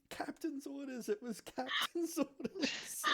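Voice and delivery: high-pitched mock yell; panicked shout. A narrator's reading. Crew member fleeing the woken sleeper, high-pitched shouting. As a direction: cry out in a high voice. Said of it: "running screaming from you, shouting".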